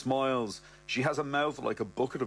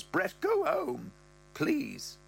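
higher mother voice, rising high at the end; mother's voice; 7 semitones higher, slower than usual. Acted. Mother tells Brett about Ross's libido, in a higher voice. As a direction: voice the mother, pitched up and prim. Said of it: "she goes".

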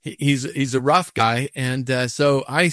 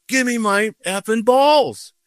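high, slow imitated order; retold angry demand; 8 semitones higher, slower than usual. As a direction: stretch out the threat as the angry golfer.

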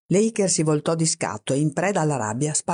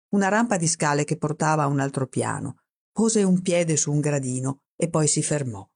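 plain reading voice

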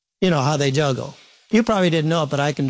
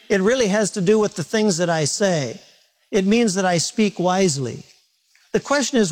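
ordinary delivery

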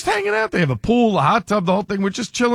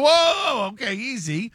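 mock alarm; 5 semitones higher, slower than usual. Telling his wife to ease off the ketchup, mock alarm. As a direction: pull back in mock alarm.

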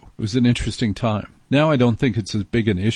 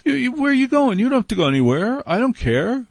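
much higher, hammed-up pleading suitor; high-pitched mock romantic protest; acted eager suitor; 12 semitones higher than usual. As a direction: call them back in a high put-on voice. Acted flirting man stopping her leaving.

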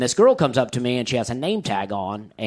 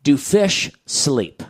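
slow, fish stretched out; earnest slow question; slow deliberate question; 2 semitones higher, much slower than usual. Retold or imitated. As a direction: ask it slowly like it's brilliant. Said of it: "This is what I said to her".

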